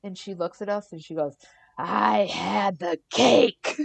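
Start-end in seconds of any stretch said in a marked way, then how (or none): 1.8-3.8 slow, loud, eerie demon growl. Retold or imitated. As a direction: drag each word out like a possessed child. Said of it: "Like a demon".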